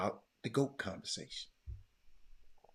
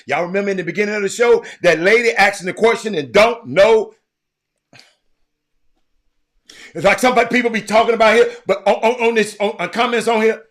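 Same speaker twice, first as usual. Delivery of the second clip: much higher, loud, fast rant; excited rant; 11 semitones higher, 19 dB louder, faster than usual. Real, not acted. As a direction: go off on everyone at once. Ranting at the audience.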